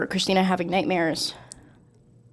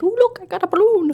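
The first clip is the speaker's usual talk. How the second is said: high, put-on creepy clown voice; playful imitation of a horror clown; luring clown; 11 semitones higher than usual. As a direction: lure a child in, pitched way up. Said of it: "he draws them in 'cause he's like".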